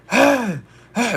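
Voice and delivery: high, loud imitated yell; crazed shouting. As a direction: mimic a crazy man's wordless shouts. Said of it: "he started shouting".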